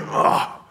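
soft breathy groan of grief; imitated groan. Preacher imitating Jesus groaning at the tomb, soft breathy groan. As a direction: let out a quiet mock groan. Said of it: "he started to groan".

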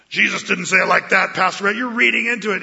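loud, preachy mock legalist. A legalist correcting Pastor Brett, loud and scornful. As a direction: scold the pastor like a rigid rule-keeper. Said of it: "the legalist puts a different tone".

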